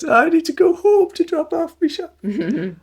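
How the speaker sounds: very high falsetto lady voice; squeaky high-pitched puppet lady. Retold or imitated. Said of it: "I can't quite do the voice anymore".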